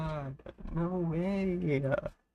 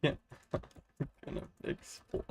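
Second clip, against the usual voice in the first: words dissolving into real laughter; helpless laughter. Real, not acted. Streamer needing to pee from laughing, helplessly giggling. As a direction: trail off breathlessly into giggles. Laughing until about to burst.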